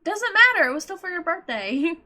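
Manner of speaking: loud, high protest; indignant. Real, not acted. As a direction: push back, indignant.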